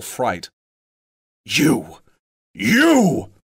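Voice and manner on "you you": high, loud furious cry; cried out. A narrator's reading. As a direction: cry out in sudden fury. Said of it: "cried Thorin".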